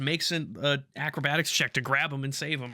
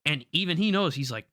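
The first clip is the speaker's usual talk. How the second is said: ordinary delivery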